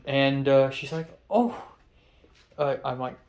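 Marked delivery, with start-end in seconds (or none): 1.3-1.6 higher-pitched mock Irish "oh"; a rough stab at an Irish accent; attempted Irish accent. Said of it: "trying to do an Irish accent".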